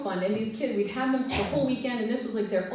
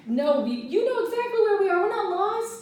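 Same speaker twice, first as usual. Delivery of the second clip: much higher, louder, pleading calm; desperate reassurance; 9 semitones higher, 3 dB louder than usual. Retold or imitated. Leader begging the girl not to cry, high reassuring. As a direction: talk her down from crying. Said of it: "I'm really just trying to make this girl not cry".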